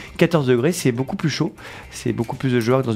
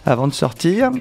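upbeat, higher radio announcer voice; radio weather announcer; 5 semitones higher than usual. Acted. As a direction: sell the forecast like a morning DJ. Improvised weather bulletin.

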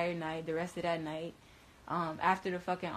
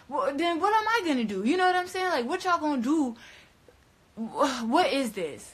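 much higher, louder, fast exasperated questions; 8 semitones higher, 6 dB louder than usual. Real, not acted. Telling viewers she felt trapped, exasperated and loud. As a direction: demand an answer from the world.

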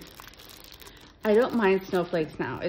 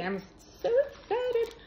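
excited; 13 semitones higher than usual. Real, not acted. Unboxing a gift package.

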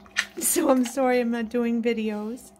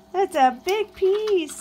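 high, slow sing-song to the bird; doting high pet voice; 8 semitones higher, 4 dB louder, slower than usual. Real, not acted. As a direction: coo it up high and drawn out. Baby talk to pet bird.

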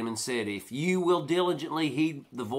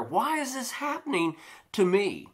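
lamenting why me; 7 semitones higher than usual. Retold or imitated. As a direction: draw out the complaint, wondering aloud. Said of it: "And we wonder".

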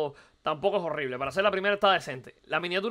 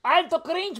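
high, scornful "Alto cringe"; cringing high mock reaction; mock disgust; 10 semitones higher, much slower than usual. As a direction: sneer like it is painfully embarrassing. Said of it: "y digo".

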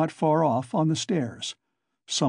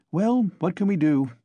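put-on higher, eager young man; 5 semitones higher than usual. A narrator's reading. Young newcomer chatting to Coke, eager. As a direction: lift it like a cheerful youth.

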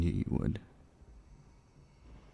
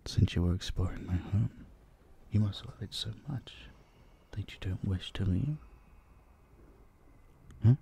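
ordinary talk, nothing marked

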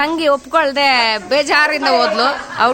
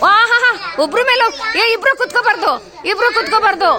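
higher, laughing-edged rule call; amused scolding; 6 semitones higher than usual. Real, not acted. Two kids on one chair.

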